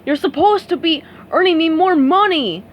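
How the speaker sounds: money-hungry boss nagging; scolding boss. A narrator's reading. Mister Krabs scolding SpongeBob about money, cranky scold.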